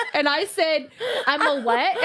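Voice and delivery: laughing through the words; laughing, incredulous; laughing disbelief. Real, not acted. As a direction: crack up while asking. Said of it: "laughing while retelling".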